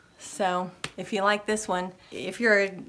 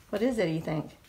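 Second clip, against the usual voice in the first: ordinary delivery, nothing marked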